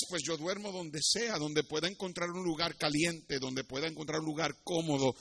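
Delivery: soft, modest explanation; subdued orphan. Acted. Street child tells the king where he sleeps, subdued. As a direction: answer low-key, keeping the volume down.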